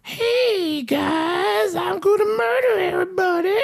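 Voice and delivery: sing-song madman announcing murder; crazy Jack. Retold or imitated. Host mocking Jack Nicholson in car, high maniac voice. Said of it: "and he's like".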